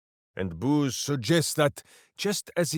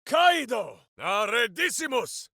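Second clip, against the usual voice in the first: shouted incantation; 8 semitones higher than usual. Acted. Casting a spell.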